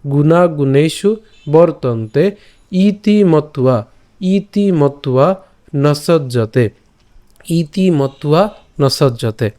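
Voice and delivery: গুণকর্ম বিভাগয় said slowly, every syllable clear; slow syllable drill. Shown as a demonstration. Teacher drilling verse words slowly for students. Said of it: "একসাথে উচ্চারণ করব".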